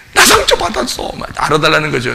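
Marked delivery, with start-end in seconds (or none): 0.1-1.1 high wailing mock of a hurt person; mock crying complaint. Preacher parodying a weeping churchgoer, high mock wail. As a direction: wail it like a sulking child near tears. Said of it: "더 심하면 뭐 울기도 해요".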